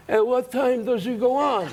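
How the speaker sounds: lightly voiced slow gangster; ringside gangster. Mobsters at ringside asking about the act, mock gangster.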